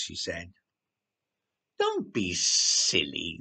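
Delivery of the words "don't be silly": slow, lower, dismissive sniff; sniffy dismissal. A narrator's reading. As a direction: brush the idea off haughtily. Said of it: "the writer sniffed".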